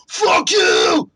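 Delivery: high-pitched, hammed-up tearful-rage shout; Todd Howard raging. Developer who just wouldn't stop, hammed-up furious shouting. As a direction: keep bellowing it, beside yourself. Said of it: "he'd be in tears".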